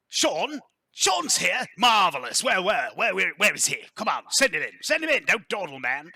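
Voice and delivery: loud, high, eager aristocrat; boisterous lord, delighted. Acted. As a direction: bellow excited orders at the servant. Game master voices excited Lord Strauss.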